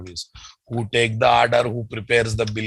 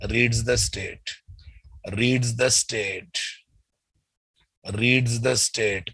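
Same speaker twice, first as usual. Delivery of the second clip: slow prompting repetition; 3 dB quieter, slower than usual. Shown as a demonstration. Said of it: "repeated slowly, prompting class".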